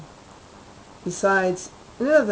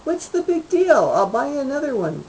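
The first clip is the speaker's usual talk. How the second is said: much higher, louder, brushing it off; breezy husband, fast on the offer; dismissive husband; 9 semitones higher, 6 dB louder than usual. Acted. Husband brushes off wife's complaint.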